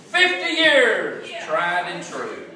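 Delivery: slow, high and loud call across the house; drawn-out shouted anniversary toast; yelling husband. Retold or imitated. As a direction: bellow it slowly to a wife in the other room. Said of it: "he yelled at her".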